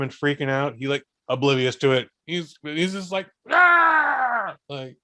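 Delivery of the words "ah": high happy scream; loud high yelp of joy; excited shriek. Retold or imitated. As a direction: let out a thrilled squeal. Kid freaking out happily.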